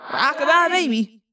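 lightly sung rockabye; joking. A narrator's reading. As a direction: tease like a lullaby. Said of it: "Marco joked".

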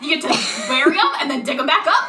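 loud, gleeful rave; dog-voiced man raving about burying bones; excited. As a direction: gush about it like a happy kid. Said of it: "he's like".

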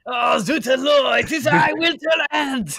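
high, shouted mock-French introduction; awful French accent. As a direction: announce yourself loudly in a hammed-up French accent. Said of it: "That is my awful French accent".

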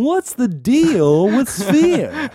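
slow, high, stand-up comic drawl; Jerry Seinfeld. Retold or imitated. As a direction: do the Seinfeld bit. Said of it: "Jerry Seinfeld".

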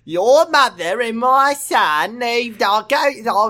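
high, slow mock Australian accent; unsure Australian accent. Co-reader mimics the doctor about mother and son, mock Australian accent. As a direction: drag out a badly faked Australian accent, high. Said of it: "Unsure about his own accent".

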